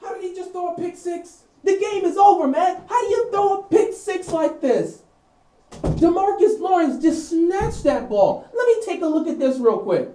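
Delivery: high-pitched, softened lament; distraught fan wailing off mic; real incredulous dismay. Real, not acted. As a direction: protest in high, pained disbelief. Still lamenting the interception live.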